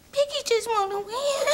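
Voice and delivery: put-on baby-talk sulk, pitched high; little baby voice. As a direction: plead like a pouting toddler. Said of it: "that little baby voice".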